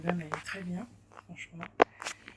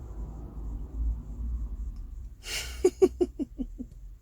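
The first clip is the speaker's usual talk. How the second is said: breathy laugh; 11 semitones higher than usual. Real, not acted. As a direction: laugh it off under your breath. Laughs about her accent.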